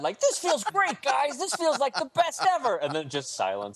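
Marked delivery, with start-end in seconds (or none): 0.2-2.8 put-on high eager kid voice; needy kid voice. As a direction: gush like a lonely kid. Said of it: "the one kid that wants friends so bad".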